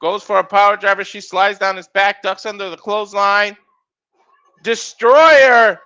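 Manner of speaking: rapid ringside calls rising on big moves; live play-by-play. Acted. Commentator calling a wrestling match to viewers, energetic play-by-play. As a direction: call the match as it happens.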